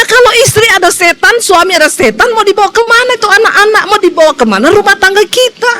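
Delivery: preacher hammering home a warning; fiery preaching. Acted. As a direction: drive the congregation with rising alarm. Preaches rising to full force.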